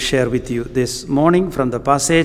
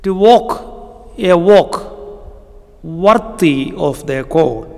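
higher, slower, emphatic preaching on unity; rising preaching fervour; 4 semitones higher, slower than usual. Acted. Preacher urging the Ephesian church to unity, fervent. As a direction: press each phrase like an urgent appeal. Preacher builds urgent appeal.

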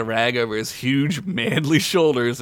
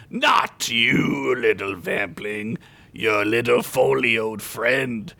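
dark lord; much slower than usual. Acted. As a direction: correct him with cold menace. Dracula corrects Boris.

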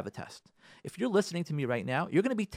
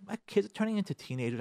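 normal talking voice

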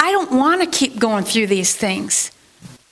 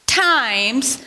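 ordinary delivery

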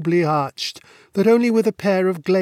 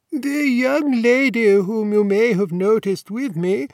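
lightly voiced deferential old warden, higher; a hint of a courteous old don; polite elderly warden, lightly higher voice; 5 semitones higher than usual. A narrator's reading.

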